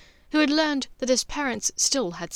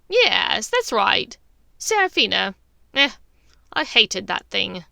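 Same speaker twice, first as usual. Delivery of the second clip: louder, settled, dismissive; satisfied Bellatrix; 6 dB louder than usual. Acted. Bellatrix relaxes on the sofa, loud and dismissive. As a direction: drop it with smug contempt.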